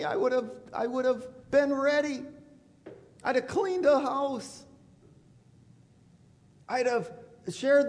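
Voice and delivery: high, pleading wail of regret; anguished crying out. Retold or imitated. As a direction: cry out like someone caught unready. Unprepared people crying at Christ's return.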